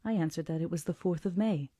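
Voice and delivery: ordinary delivery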